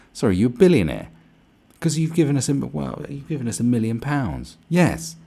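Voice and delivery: loud, high role-play exchange; hammed-up high, loud voice; mock charity worker. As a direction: act out an incredulous charity call. Acts out charity turning away a billionaire.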